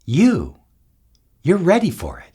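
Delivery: ordinary talk, nothing marked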